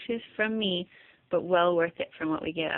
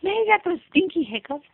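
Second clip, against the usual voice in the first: put-on baby talk; 9 semitones higher, 3 dB louder than usual. Talking to baby.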